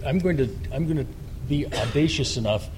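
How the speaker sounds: ordinary talk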